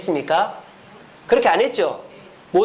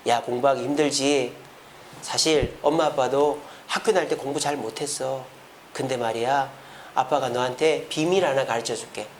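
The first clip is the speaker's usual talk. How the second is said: lower, soft acted parent talk; gentle confiding; 5 semitones lower, 5 dB quieter than usual. Parent admitting to a child studying was hard, gently confiding. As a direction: confide in a child, easing the pressure. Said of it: "불러다가 얘기를 하세요".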